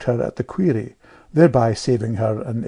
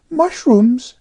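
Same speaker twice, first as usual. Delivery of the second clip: put-on high, louder piping girl; piping know-all child; 13 semitones higher, 6 dB louder than usual. A narrator's reading. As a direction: pipe up like a little know-all. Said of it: "piped Amelia".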